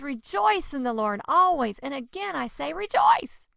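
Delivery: rising to a high, loud rejoice; exultant scripture quote; joyful proclamation. Acted. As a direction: lift the congregation with the verse.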